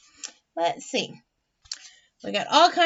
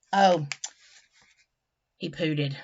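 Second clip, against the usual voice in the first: plain reading voice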